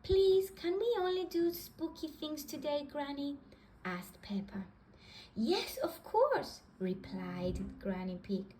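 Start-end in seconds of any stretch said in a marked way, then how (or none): 0.0-3.4 put-on high, bright little girl voice; little pig girl asking her granny; Peppa. Little girl asking Granny for spooky fun, high. 5.3-6.6 high, slow granny voice; kindly grandma agreeing. Acted. Granny answering Peppa, slow and high.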